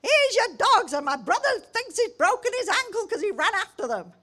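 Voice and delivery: high, loud, indignant complaint; angry neighbour. Retold or imitated. Neighbour complains her brother hurt his ankle, high angry scolding. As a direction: scold them like the furious neighbour. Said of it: "So she's like".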